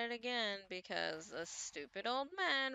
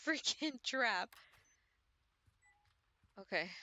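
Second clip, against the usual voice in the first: ordinary delivery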